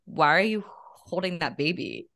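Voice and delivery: ordinary delivery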